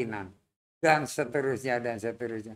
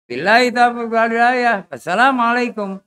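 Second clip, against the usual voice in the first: formal closing; 3 semitones higher, 8 dB louder than usual. Real, not acted.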